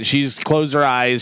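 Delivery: usual speaking voice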